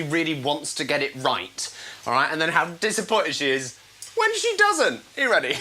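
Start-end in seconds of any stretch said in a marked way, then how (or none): none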